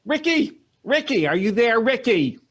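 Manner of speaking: high-pitched, insistent calling; Ricky's mother. Acted. Mother comes on the radio for Ricky, insistent. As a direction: call your son in a high voice. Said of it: "another voice comes on there".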